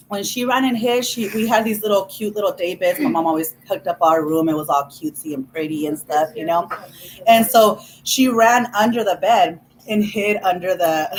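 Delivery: normal talking voice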